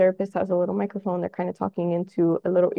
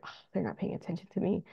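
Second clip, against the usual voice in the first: much softer, fast mutter to self; child's muttered thought; 10 dB quieter, faster than usual. Retold or imitated. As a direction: grumble it under your breath like a sulking kid. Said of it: "they're like".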